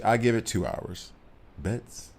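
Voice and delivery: ordinary delivery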